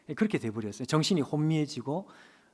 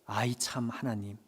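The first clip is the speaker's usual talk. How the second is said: whispered sigh of complaint; 6 semitones lower, 7 dB quieter than usual. Retold or imitated. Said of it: "이렇게 반응을 했다".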